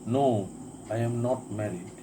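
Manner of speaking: normal talking voice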